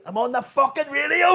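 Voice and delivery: high, loud laughing yell; exasperated laughing shout at the bus driver. Retold or imitated. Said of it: "I'm saying".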